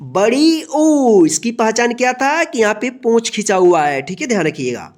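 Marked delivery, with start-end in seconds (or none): 0.0-1.2 बड़ी ऊ said very slowly, the ऊ long and falling; slow emphasis. Shown as a demonstration. Teacher stressing which ऊ it is to pupils. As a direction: stretch बड़ी ऊ out to drive it home. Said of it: "कौन सा ऊ है?".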